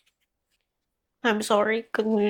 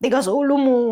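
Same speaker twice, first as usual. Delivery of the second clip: higher, louder mock parental scold; retold scolding; 6 semitones higher, 6 dB louder, faster than usual. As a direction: snap it out like a parent telling off a stubborn kid. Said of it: "sinasabihan ka na".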